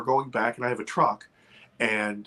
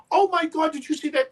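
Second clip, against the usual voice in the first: high, excited exclamation; mock-breathless wonder; awestruck cry. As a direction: gasp it out at the sight. Retold awestruck call to companion.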